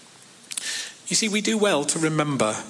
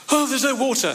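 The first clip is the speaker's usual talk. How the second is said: mock whine; 8 semitones higher than usual. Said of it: "I find that kind of slightly amusing".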